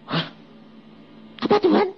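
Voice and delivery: high, startled cry to God; hearing God name his bride and recoiling in shock; startled question. Retold or imitated. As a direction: jump up in shock.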